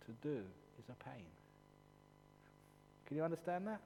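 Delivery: usual speaking voice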